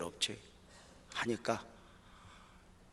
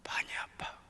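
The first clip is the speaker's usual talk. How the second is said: whisper; 6 dB quieter than usual. Retold or imitated. Opening retold line, whisper.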